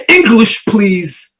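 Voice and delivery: loud, high yell, dropping on the second word. Acted. Man on the train yells at a Spanish speaker, loudly. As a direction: bark it as an order at a stranger. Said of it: "the man on the train that yelled".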